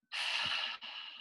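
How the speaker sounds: long, soft throaty exhale; audible breath demo. Shown as a demonstration. Teacher has everyone follow along, audible breath demo. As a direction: sigh out a voiceless "haah". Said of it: "breathing with the back of our throat".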